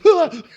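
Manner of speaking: high mock shriek for the bear; sudden high yelp, hammed-up animal fright; imitated bear's startled cry. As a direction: play the scared bear yelping back. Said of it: "The bear goes".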